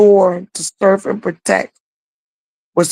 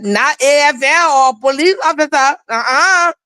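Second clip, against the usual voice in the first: put-on higher boyish voice with a Liberian accent; 6 semitones higher than usual. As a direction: say it firmly like a Liberian boy refusing. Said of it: "In his Liberian accent".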